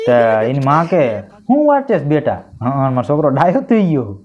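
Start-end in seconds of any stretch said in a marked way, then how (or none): 1.2-2.3 high, doting question; sweet mother. Acted. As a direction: ask proudly like a doting mom.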